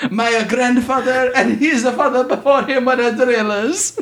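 slow, high-pitched hammed-up Italian accent; ridiculous Italian accent. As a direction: stretch it out in cartoon Italian. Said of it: "I apologize to all Italian listeners".

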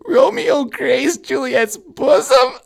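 slow, very high, loud stoner reading; stoned student reading. Retold or imitated. Stoned student reading Shakespeare to class, high loud squeal. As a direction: belt it out high and drawn-out, mimicking a baked kid. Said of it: "And he goes".

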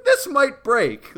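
high, slow, worried; mock panic. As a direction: fret about a bending leg. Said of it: "I could kinda feel like".

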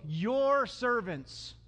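drawn-out, fawning mock appeal; butter up the boss, very slowly; slow, whiny stress on "your servants"; sucking up. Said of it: "sucking up to Pharaoh".

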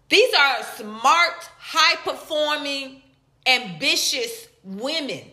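slow, deliberate stress on each phrase; hammering home each word slowly; slow emphatic list. Real, not acted. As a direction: lay each point down slowly.

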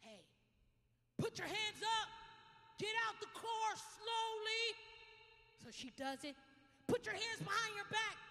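loud, high barked orders, slowed; police commands. Retold or imitated. Officer shouting orders at the lady in the car. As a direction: command like an officer with gun drawn. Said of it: "And he says".

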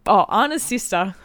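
normal talking voice

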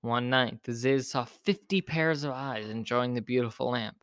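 plain reading voice

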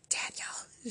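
hushed, eager whisper; excited whisper. A narrator's reading. Victoria greeting Daniel at the table, excited whisper. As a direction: whisper it like a secret you're bursting to share. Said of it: "she whispered excitedly".

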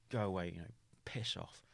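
ordinary talk

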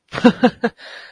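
real burst of high laughter; short high giggle. Real, not acted. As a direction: laugh out at your own joke. Laughs at own joke.

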